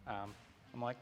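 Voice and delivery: usual speaking voice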